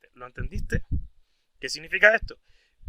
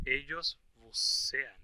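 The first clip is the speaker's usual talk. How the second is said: slow model answer; 3 semitones lower, 4 dB quieter, much slower than usual. Shown as a demonstration. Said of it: "slow model translation".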